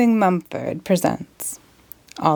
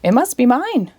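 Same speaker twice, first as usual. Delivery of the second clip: chirped; 5 semitones higher than usual. A narrator's reading. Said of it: "chirped a beautiful bird".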